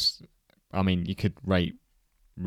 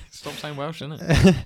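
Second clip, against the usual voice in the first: a light teasing Welsh lilt on innit; half-laughing mock Welsh, higher; 7 semitones higher, 7 dB louder, slower than usual. Host teases co-host with a higher Welsh lilt. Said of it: "mocks co-host's Welsh impression".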